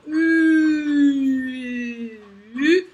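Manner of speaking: held loud u; vowel sound U. Shown as a demonstration. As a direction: sing out "U". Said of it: "il fait le même son".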